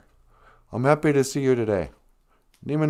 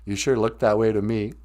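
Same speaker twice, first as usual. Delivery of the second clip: ordinary delivery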